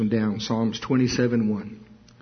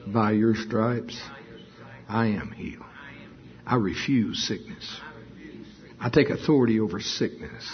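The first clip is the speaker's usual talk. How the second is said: prayer. Acted. Prayer confession over congregation.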